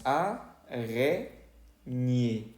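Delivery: "a, re, ñe" slowly, French R, split apart; syllable by syllable. Shown as a demonstration. Teacher building the French word for spider, separate syllables. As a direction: break it into "a... re... ñe".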